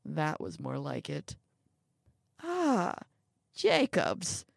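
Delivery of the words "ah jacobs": put-on old man's high, drawn-out call; elderly man crying out. A narrator's reading. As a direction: call out a name in greeting, pitched up. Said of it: "cried out the old man".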